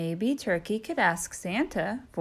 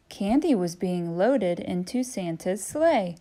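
ordinary delivery

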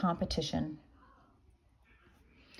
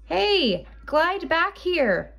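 high, slow mock yell; wolf yelling; 8 semitones higher, slower than usual. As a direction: call her back in a stretched-out holler. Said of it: "yelled the wolf".

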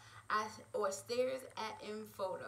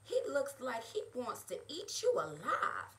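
usual speaking voice

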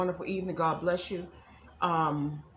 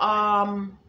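normal talking voice

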